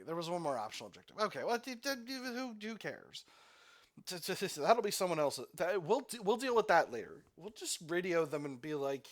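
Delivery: usual speaking voice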